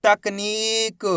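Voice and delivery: तकनीक very slow, with a long नी; teacher drawing out तकनीक; blended slow reading. Shown as a demonstration. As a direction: join तकनीक slowly, stretching the ee. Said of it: "मिलके क्या बनेगा".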